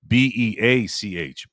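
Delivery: slow spelling of "B E A C H", letter by letter. Shown as a demonstration. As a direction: spell "B E A C H" slowly, each letter apart. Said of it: "It's supposed to be B E".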